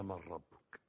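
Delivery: low, soft reading of a question; quiet recitation. Retold or imitated. Preacher first quoting the grave angels' question, quiet recitation. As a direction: recite it softly and low, without force.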